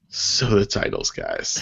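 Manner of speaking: low groggy morning voice. Real, not acted. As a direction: sound just woken up, groggy. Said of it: "your morning voice going right now".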